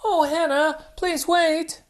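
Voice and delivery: high, pleading little piglet; apologetic Peppa. Acted. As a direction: beg forgiveness, high and quick.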